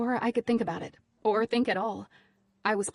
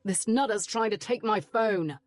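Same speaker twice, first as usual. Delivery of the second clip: Brit; 2 semitones higher than usual. A narrator's reading. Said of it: "the Brit said".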